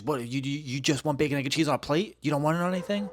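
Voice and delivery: a rude cashier mocking his breakfast order. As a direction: act out her scorn at the odd order. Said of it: "that shit was fucking rude as fuck".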